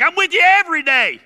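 loud, high, indignant outburst; worked-up cry of disbelief; exasperated God. Acted. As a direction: shout it in exasperation. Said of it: "He's like".

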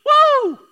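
mock celebratory woo; sarcastic whoop. Real, not acted. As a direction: whoop like a fake cheer. Preacher cheering sarcastically.

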